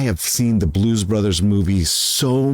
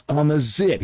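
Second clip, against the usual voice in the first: higher, goofy gross-out line; mock Belushi, pleased with himself; imitating Belushi; 7 semitones higher than usual. Man retelling Animal House cafeteria joke, light goofy Belushi imitation. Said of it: "And he goes".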